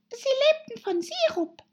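very high, squeaky little voice; high-pitched sleepy mouse; dormouse. A narrator's reading. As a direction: answer in a tiny mouse squeak. Dormouse figure answers.

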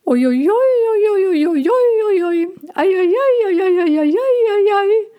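very high, loud bird calls; high dove cooing. A narrator's reading. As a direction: coo like excited doves from the sky. Doves calling from above.

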